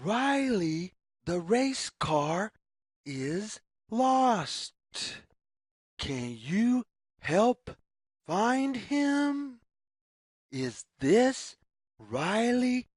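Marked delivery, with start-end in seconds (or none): none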